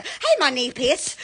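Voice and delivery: ordinary talk, nothing marked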